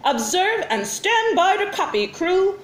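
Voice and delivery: lightly voiced alien commander; commanding alien. A narrator's reading. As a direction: bark orders like a space captain. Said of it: "ordered the commander".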